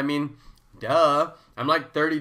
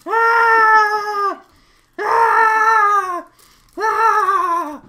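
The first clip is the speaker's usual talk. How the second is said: high, loud thrilled screams; excited scream; 10 dB louder, much slower than usual. Real, not acted. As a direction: shriek with joy. Screams over second snack.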